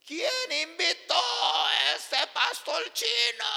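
high, drawn-out angry shout; angry husband. Retold or imitated. Husband fighting with his wife in the bedroom, angry shouting. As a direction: yell it out as a furious husband, slowly. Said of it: "estaba peleando con su marido".

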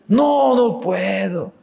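high, slow "no, no puedo"; disbelief. Retold or imitated. Bolivian still won't believe it, high slow disbelief. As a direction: shake your head in disbelief.

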